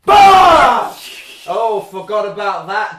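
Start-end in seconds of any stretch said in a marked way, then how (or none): 0.0-1.0 loud mock scare shout.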